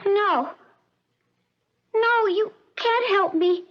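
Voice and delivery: put-on high, small lost-girl voice; childlike voice. Withdrawn patient answering her visitor in a high childlike voice. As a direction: speak like a frightened little girl. Maureen refusing help, childlike.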